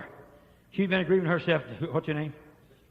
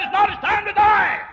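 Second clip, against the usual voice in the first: loud, high proclaiming shout; shouted divine verdict; 14 dB louder than usual. Retold or imitated. Preacher voicing God again, even louder. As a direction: hammer the verdict home. God's word repeated, shouted.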